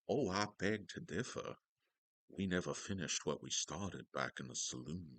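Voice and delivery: quiet, genteel dandy accent impression; Val Kilmer. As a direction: do a hushed dandy accent from an old Western. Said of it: "what that accent Val Kilmer was doing".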